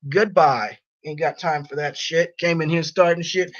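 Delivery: usual speaking voice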